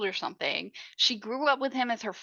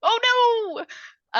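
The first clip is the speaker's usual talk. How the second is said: high, anguished ugh, oh no; dismayed groan; 13 semitones higher, 5 dB louder than usual. Real, not acted. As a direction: whimper in horror at what's coming. Dreading the girl's murder.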